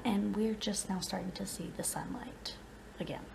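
whispering. Real, not acted. Kids asleep.

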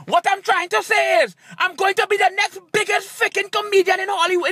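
high-pitched, forceful outburst; exasperated declaration. Acted. Comedian retrying his line after a tongue twister, exasperated.

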